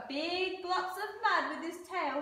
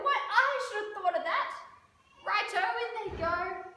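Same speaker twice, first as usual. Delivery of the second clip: high, loud, pleased Dingo; delighted exclamation; 8 semitones higher, 4 dB louder than usual. A narrator's reading. Dingo takes up Echidna's advice, high and eager.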